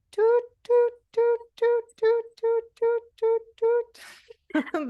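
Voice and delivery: very high, repeated doot like a truck's reversing beep; high owl tooting for the class; saw-whet owl toot. Shown as a demonstration. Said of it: "And again, everyone".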